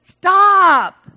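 high, very loud drawn-out halt; exasperated divine outburst; commanding shout. Acted. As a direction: shout it to cut them off. Acts out God halting Elijah.

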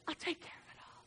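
whispered reassurance from God; tender whisper. Acted. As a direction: drop to a gentle whisper of comfort. God comforting the faithful.

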